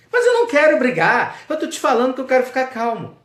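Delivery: bem mais agudo e mais alto, insistente; discípulo irritado. Acted. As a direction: proteste como discípulo exasperado. Discípulo insiste com o mestre. (discípulo insistindo com o mestre, agudo e exaltado)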